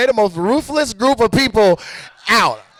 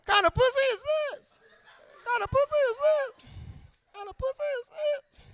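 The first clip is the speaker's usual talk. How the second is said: high, faint far-off repeats; fading echo; 12 dB quieter than usual. Acted. Comic's question bounces back, fading echo. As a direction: repeat it high and tiny, dying away. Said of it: "First pussy echo".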